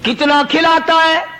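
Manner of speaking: fervent sermon praising the provider; preaching at full force. Acted. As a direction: draw out every phrase to the crowd.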